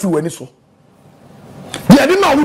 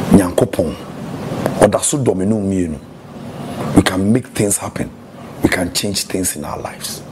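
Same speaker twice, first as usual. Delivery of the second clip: quiet closing benediction; preaching blessing; 13 semitones lower, 4 dB quieter than usual. Acted. Pastor blessing his listeners, calm sermon close. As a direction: bless them softly, again and again.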